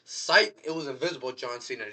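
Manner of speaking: high, loud fake amazement, then a flat psych reveal; mock fan surprise. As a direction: gasp at the comeback, then drop it. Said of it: "people to be like".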